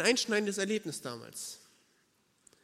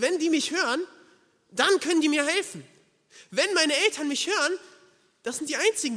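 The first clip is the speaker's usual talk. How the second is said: urgent desperation; 8 semitones higher, 7 dB louder than usual. Retold or imitated. Lost child remembers desperate hope.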